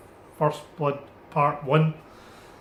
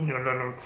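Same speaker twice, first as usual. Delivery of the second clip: softer descending trap noise; imitated sound effect; 2 semitones lower, 5 dB quieter than usual. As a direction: mimic a trap swallowing a ghost. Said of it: "with all those sound effects included".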